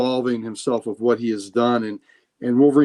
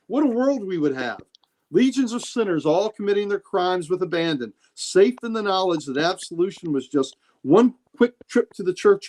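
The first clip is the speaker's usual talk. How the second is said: higher-pitched, lilting speech of an impassioned priest; Nightcrawler; 5 semitones higher than usual. Acted. Priest answers his friend's plea for absolution, ironic and impassioned. Acts out Kurt's speech from comic.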